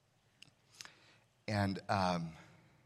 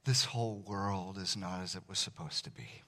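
prayer; 2 semitones lower, 7 dB quieter than usual. Acted. Leads closing prayer.

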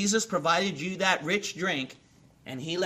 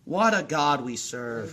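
low, slow praise; reverent awe; 6 semitones lower than usual. Real, not acted. Preacher marvelling at God's grace, reverent and low. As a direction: let it sink low and unhurried in awe.

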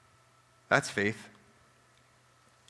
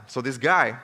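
normal talking voice

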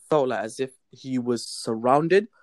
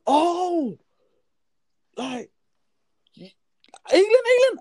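much higher, loud disbelieving squeal on England England; imitating shocked driver; 4 dB louder, slower than usual. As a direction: mimic a stunned taxi driver who can't believe it.

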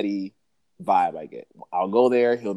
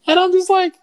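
shocked squeal; 9 dB louder than usual. Real, not acted. Reacting to bartender's insult.

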